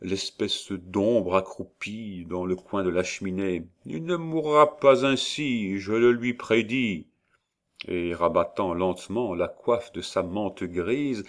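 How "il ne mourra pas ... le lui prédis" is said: high, eerie prophesying hag; witch voice. A narrator's reading. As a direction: foretell it like a witch.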